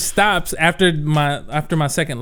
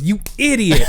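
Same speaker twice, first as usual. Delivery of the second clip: scolding mom; 5 semitones higher, 4 dB louder than usual. Retold or imitated. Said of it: "she goes".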